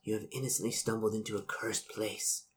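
put-on soft, hushed warning from a stern old noblewoman's portrait; hushed, ominous portrait voice; ghostly portrait. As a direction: speak low-volume, like a haunted painting warning a stranger.

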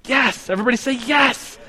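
high, eager call for the class to answer yes; rally the room to shout yes with you; emphatic yes. Real, not acted. Teacher asks class about heresy.

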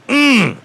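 excited squeal. Real, not acted. Excited squeal before "I'm excited.

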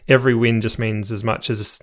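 ordinary talk

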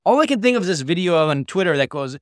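ordinary talk

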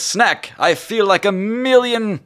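high, loud, showy genie brag. Acted. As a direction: boast like a flamboyant genie. GM voices the genie.

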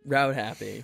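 "Route" rhymes with "out"; rout pronunciation. Acted. Host imagines founder correcting people, "Route" as rout. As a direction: say "Route" with an ow vowel. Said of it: "were like, no, it's".

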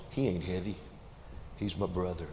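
quiet, tender retelling of the line; preacher softly repeats the boy's words; soft tender echo. Acted. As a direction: let it land gently, almost hushed.

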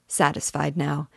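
plain reading voice

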